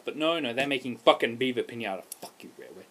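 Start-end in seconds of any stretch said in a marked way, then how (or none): 2.2-2.8 very low, hushed aside; quiet curse. Real, not acted. Gamer still cursing the developer, low and quiet. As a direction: curse under your breath. Same curse repeated under the breath.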